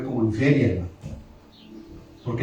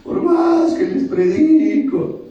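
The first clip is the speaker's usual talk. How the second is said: slow, high-pitched whiny lament; mock bitter crying; 7 semitones higher, slower than usual. Preacher complaining to wife and children about congregation, mock wailing. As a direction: drag it out like an exaggerated sobbing complaint. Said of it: "voy a llorar amargamente".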